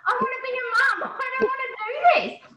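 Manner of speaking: high, loud, tearful wail half-laughing; retold crying. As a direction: replay the meltdown, pitched high and tearful. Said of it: "He me burst out crying".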